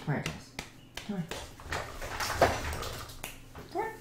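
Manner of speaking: quiet, breathy come-here coo; soft pet calling. Real, not acted. Streamer quietly calling her dogs over, soft coo. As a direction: gently coax the dog over.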